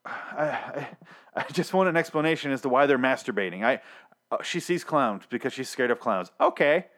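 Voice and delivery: normal talking voice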